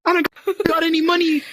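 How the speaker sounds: high, panicky, backing away; panicked refusal. Acted. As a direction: yelp no and plead broke. Refusing a stranger's demand.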